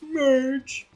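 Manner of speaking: very high, strained cartoon husband pleading; Homer Simpson. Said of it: "when I'm trying to be Homer as well".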